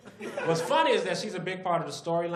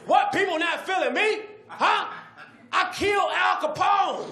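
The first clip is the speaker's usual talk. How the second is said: very high, loud indignant yell; hammed-up furious; outraged syphilis; 6 dB louder than usual. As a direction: scream it in disbelief.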